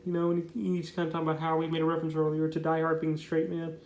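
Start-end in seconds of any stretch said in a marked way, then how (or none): none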